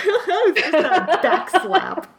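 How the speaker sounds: laughing through the words; amused, laughing while talking. Real, not acted. Laughing at a cruel line.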